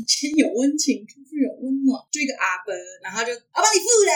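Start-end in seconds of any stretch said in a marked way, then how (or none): 3.5-4.1 calling out. Retold or imitated. Calling to old man on bus.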